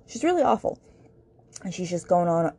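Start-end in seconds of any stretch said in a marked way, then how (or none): 0.1-0.8 exasperated outburst about a judgmental mother; emphatic disgust. Real, not acted. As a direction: lean hard into how awful she is. Repeats verdict on Grace's mom.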